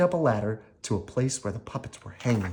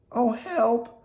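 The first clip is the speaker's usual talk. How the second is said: put-on high, small puppet-boy cry; Pinocchio calling for help; 13 semitones higher than usual. Pinocchio trapped in the birdcage, calling high for help. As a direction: call out from the cage, high. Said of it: "called Pinocchio from inside the cage".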